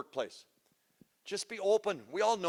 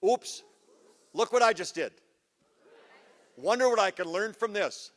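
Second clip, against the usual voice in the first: cheerful demo; 3 semitones higher, 3 dB louder than usual. Shown as a demonstration. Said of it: "say this with me".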